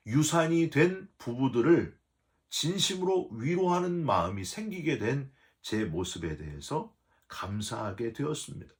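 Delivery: normal talking voice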